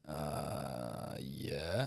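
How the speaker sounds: lightly imitated Croatian accent, hesitant and hushed; a quiet, unsure mother-in-law with a Croatian lilt. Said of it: "She's like".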